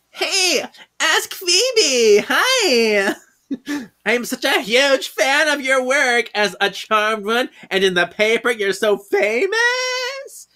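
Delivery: high-pitched gushing superfan; Miss Retta. Acted. Fan approaches Phoebe, hammed-up high gushing. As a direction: squeal it, stretching the last word out.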